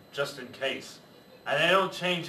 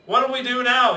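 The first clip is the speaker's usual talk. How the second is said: loud, higher pirate yell; panicked yell; 8 semitones higher, 6 dB louder than usual. Acted. Panicked pirate after the mast splits, loud yell. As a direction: yell it in the storm. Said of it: "yelled one of the pirates".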